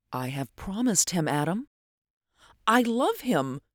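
hesitant, pausing confession with a high finish; faltering voice. A narrator's reading. Athalia tells Adam she loves another, faltering. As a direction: falter gently through the refusal. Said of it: "given with a faltering voice".

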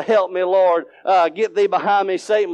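understated retold plea at normal volume; voiced prayer, only lightly called out; mild crying out. Man at work praying against persecution, mildly crying out. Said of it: "and I can't cry out".

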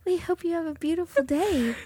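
high, soft sweet princess voice; cartoon princess. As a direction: chirp it like a storybook bird. Continuing princess bird voice.

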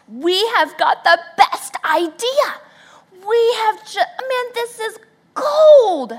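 high, loud, laughing excitement; mock thrilled husband; excited husband. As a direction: bubble over with the idea. Husband excited about idea.

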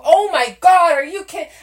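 high, loud retold disbelief; outraged outburst. Girlfriend learning he was just kidding, retold outraged cry. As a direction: re-enact an exploding, high-pitched outrage. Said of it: "I go".

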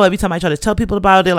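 normal talking voice

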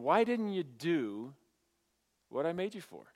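drawn-out, pained reproach with a pause midway; compassionate but stern. Acted. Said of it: "real compassionate, but at the same time very stern".

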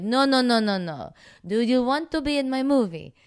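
mock Italian director pushing harder; Italian accent. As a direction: ham up the Italian director's broken English. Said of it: "That's better".

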